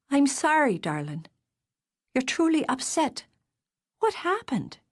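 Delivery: lightly voiced sister turning gentle; a hint of sisterly worry; concerned sister. Darcy sees he is upset.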